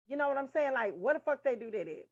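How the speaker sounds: ordinary talk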